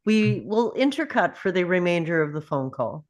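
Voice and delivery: ordinary talk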